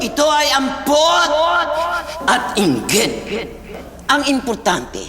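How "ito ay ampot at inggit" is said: angry shout. Shown as a demonstration. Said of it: "tandaan ang dalawang importanteng emosyon".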